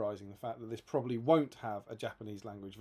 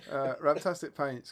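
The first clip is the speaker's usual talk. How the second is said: normal talking voice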